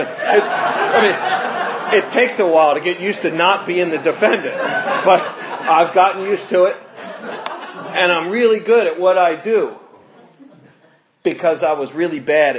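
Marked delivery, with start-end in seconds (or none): 0.0-9.8 high, loud talk through laughter; real laughter at himself; laughing. Real, not acted. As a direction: keep talking while you laugh. Laughing through his story.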